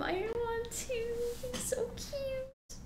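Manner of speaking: cooing over a dog. Real, not acted.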